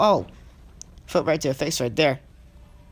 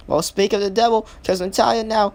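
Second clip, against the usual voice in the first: higher, louder commentary surge; excited play-by-play; 6 semitones higher, 4 dB louder than usual. Real, not acted. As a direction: lift the call as the match turns. Commentator reacts to sudden turn in match.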